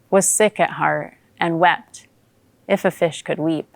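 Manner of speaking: usual speaking voice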